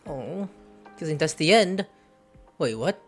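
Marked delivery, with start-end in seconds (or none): none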